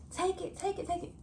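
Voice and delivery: high mock yell of take it; retold yell. Friend on the boat yelling at the photographer, high mock yell. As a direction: call out like a friend posing on a boat. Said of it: "I can hear her yelling".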